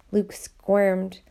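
ordinary delivery

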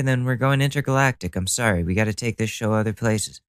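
ordinary talk

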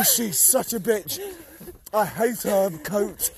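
ordinary talk

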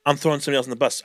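ordinary delivery

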